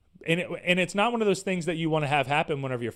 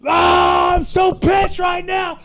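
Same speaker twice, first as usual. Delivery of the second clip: very slow, loud mock shout, higher; blow a load; 11 semitones higher, 10 dB louder, much slower than usual. Venting at the store staff in a hammed-up tantrum shout. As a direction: stretch each word out in a hammed-up tantrum yell. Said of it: "just blow a load and just be like".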